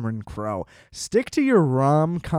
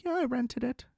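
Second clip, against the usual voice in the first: high, soft mock relative; lightly voiced family member; quoted relative; 9 semitones higher, 7 dB quieter than usual. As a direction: toss it off like a proud relative.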